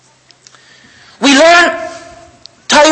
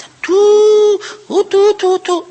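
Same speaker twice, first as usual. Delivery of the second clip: mock shofar blast; 11 semitones higher, much slower than usual. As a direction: toot like a shofar.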